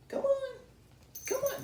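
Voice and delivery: high coaxing call to a pet. Acted. Coaxing Lizzie up, high and sweet. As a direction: sweet-talk her up, high.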